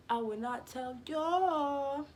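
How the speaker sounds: high slow taunt. Real, not acted. Repeated higher.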